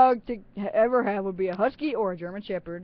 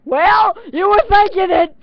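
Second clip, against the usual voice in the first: louder, higher hammed-up accusation; mock shout; 10 semitones higher, 11 dB louder than usual. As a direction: yell it back in playful mock outrage. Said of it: "I'm like".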